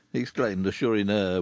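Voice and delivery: ordinary delivery, nothing marked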